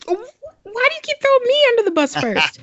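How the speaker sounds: high-pitched playful protest; mock outrage. Real, not acted. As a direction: squeal it as a joking complaint. Mock complaint at being picked.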